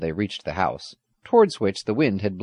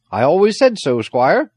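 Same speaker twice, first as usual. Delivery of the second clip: loud, raised, proud agreement; hearty satisfaction; 9 semitones higher, 8 dB louder than usual. A narrator's reading. Old soldier agreeing with the squire, satisfied. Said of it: "his face beaming with satisfaction".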